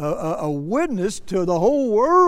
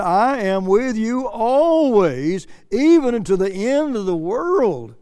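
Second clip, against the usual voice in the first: slow and louder, lifting the promise high; raised, slow scripture reading; 5 semitones higher, 5 dB louder, slower than usual. Acted. Preacher quoting Christ's promise to the congregation, slow and raised. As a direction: proclaim it slowly from the pulpit.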